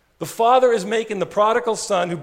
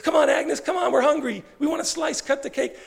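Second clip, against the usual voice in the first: high, chirpy coaxing women; cheery friends; 9 semitones higher than usual. Retold or imitated. Prostitutes urging Agnes to cut her birthday cake, high and cheery. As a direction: coax her along playfully. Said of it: "same friends continue".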